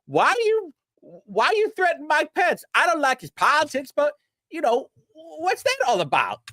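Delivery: high put-on mocking voice held throughout; high-pitched mockery of a whiny critic; mocking a weirdo. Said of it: "fucking weirdos who's like".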